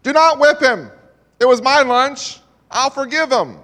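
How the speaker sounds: loud, high urgent plea to stop; crying out. Retold or imitated. Bully cries out to the teacher, loud high plea. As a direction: shout it out to save the small boy. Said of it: "Jim cried out".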